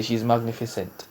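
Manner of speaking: normal talking voice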